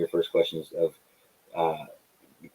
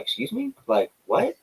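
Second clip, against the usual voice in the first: mock surprise. Surprised by a fan's request.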